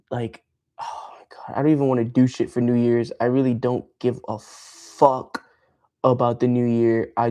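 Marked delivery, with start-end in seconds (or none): 0.1-1.6 slow, exasperated whisper; fed-up whisper. Real, not acted. Streamer venting to chat he does not care about New Year, whispering. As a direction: sigh the words out.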